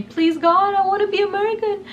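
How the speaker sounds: high, fast desperate plea to God; high pleading whisper-prayer. Retold or imitated. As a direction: beg God with everything you've got. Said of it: "I was already praying".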